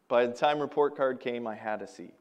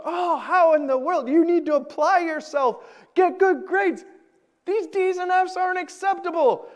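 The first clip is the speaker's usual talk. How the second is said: much higher, shrill mock yelling of angry parents; mock parent scolding; 4 dB louder than usual. Parents scolding the failing son, hammed-up yelling. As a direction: ham up the furious parents. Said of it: "they're yelling and they're screaming".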